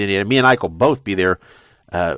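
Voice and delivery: normal talking voice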